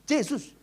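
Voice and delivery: Yesus with a j; Batak Jesus pronunciation. Retold or imitated. Elder repeats Yesus again as Jesus. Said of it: "Bukan, salah".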